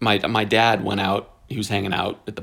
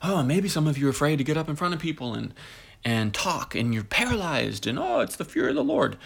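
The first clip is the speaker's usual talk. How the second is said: mocking the pastor; 5 semitones higher, 3 dB quieter than usual.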